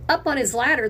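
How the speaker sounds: ordinary delivery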